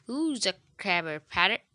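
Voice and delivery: parrot voice. Acted. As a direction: parrot repeating in a put-on squawky voice. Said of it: "says Polly".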